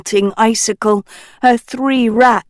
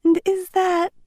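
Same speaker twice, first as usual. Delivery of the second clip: put-on light, higher young princess voice; a cheerful princess passing on news; Uma's voice; 8 semitones higher than usual. Uma's floating face telling the crew, light princess voice.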